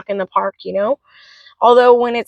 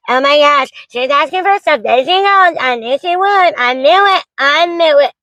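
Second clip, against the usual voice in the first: lightly raised, mocking voice of her critics; mocking complainers; 4 semitones higher than usual. Single mom mimics online critics in a mildly mocking voice. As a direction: sneer it like a gossiping critic. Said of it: "Please don't start complaining".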